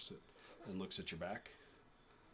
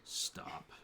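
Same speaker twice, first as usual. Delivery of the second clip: put-on old woman whispers "Stop." once she arrives; hushed command.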